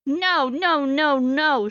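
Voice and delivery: lightly acted shouted refusal; child's shout. A narrator's reading. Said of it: "she shouts".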